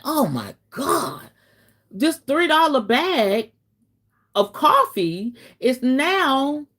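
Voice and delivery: high, slow, outraged disbelief; dramatic shock. Retold or imitated. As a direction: gasp it out, scandalized. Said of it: "Y'all know I'm just dramatic".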